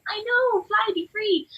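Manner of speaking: high, loud, joyful send-off; excited cheer. Real, not acted. Teacher cheers her student on, high loud joyful. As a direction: release them like birds, beaming.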